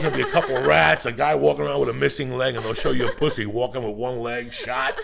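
loud giggling riff; laughing rant. Real, not acted. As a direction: rant while cracking up. Laughing rant about apocalypse.

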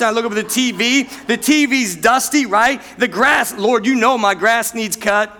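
ordinary delivery

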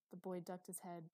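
ordinary delivery

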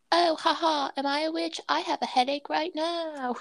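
light mocking lilt; mock self-quote. Mocking her own earlier self.

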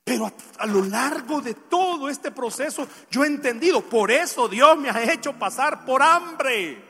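emphatic pulpit delivery; impassioned preaching. Real, not acted. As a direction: drive the lesson home to the congregation. Preaches with force.

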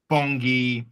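ordinary talk, nothing marked

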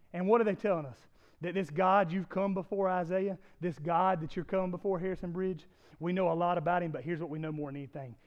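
usual speaking voice

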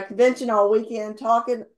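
ordinary talk

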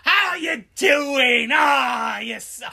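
hammed-up London geezer hello; lightly put-on chummy Cockney. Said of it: "like a stereotypical British".

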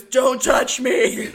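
a loud, slow, strangled "Don't touch me"; hammed-up demon snarl breaking into a laugh; mock possessed girl. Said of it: "And she goes".

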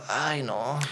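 a quiet, weary "ay, no"; soft dismayed groan. Retold or imitated. Passenger dreading a rant, quiet dismay. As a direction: sigh it out under your breath.

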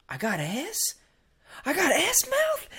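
high, rising mock-incredulous question; imitated disbelief. Crazy friend finding out what he tasted, mock disbelief. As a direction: question it in comic shock, laughing through.

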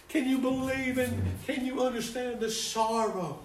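high, loud, fervent preaching cadence; impassioned preaching. Acted. Preacher to congregation, high loud fervent preaching.

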